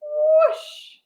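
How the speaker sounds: whoosh. Shown as a demonstration. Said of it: "Can you jump high?".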